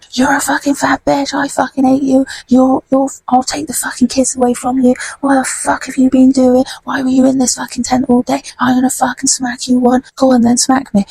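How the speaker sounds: high-pitched mock rant, not shouted; imitating angry man. Man threatening wife, retold row.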